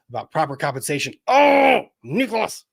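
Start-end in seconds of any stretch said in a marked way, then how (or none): 1.3-2.6 high, loud mock-outraged groan of a name; exasperated outcry. Streamer caught out by chat member Nicholas, mock exasperation. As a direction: cry out in hammed-up exasperation.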